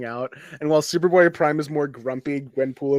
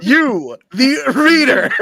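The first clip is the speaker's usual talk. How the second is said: emphatic; 9 semitones higher, 10 dB louder, slower than usual. Real, not acted. Pointing at fans jokingly.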